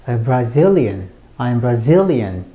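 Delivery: slow "Brazilian", only "zil" stands out; stress on ZIL. Shown as a demonstration. Teacher drills learners, repeated heavy stress on "zil" in "Brazilian". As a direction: punch the middle syllable of "Brazilian", falling after it. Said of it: "zeal만 크게 들리게 되겠습니다".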